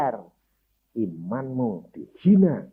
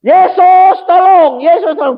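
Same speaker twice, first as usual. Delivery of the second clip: loud high desperate cry; mother calling loudly for help, then fading; 12 dB louder than usual. Acted. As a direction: call out for help at the top of the voice. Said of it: "teriak-teriak".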